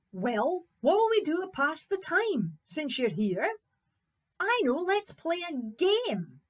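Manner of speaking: loud, high, chirpy suggestion; undaunted Fritz, eager. Acted. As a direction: propose a game to the giant with gusto. Fritz chats to giant.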